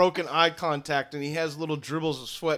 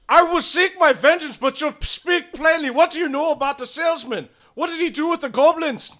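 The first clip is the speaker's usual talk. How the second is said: high, loud vengeful demand; vengeful shout; 12 semitones higher, 7 dB louder than usual. Acted. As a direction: demand answers like a grieving father.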